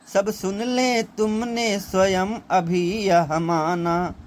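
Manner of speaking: "सब सुन ले तुमने स्वयं अभी यह माना" recited slowly and drawn out as verse; slow lyrical reading of "सब सुन ले तुमने स्वयं अभी यह माना"; poem recitation. Acted.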